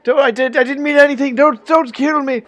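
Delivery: high, loud panicked begging; frightened old man begs not to be killed; terrified pleading. Acted. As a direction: beg for your life in a panic. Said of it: "he looks pretty frightened".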